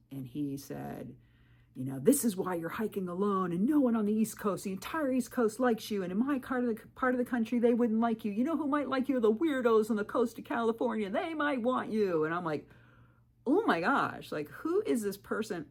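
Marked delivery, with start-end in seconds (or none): 2.0-12.2 mock angry snap, higher and louder; snapping. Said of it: "And he snapped". 13.5-15.7 a retold gasp of disbelief, higher and louder; shocked. Reacting to his insult.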